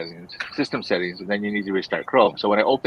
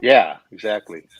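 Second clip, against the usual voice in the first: ordinary talk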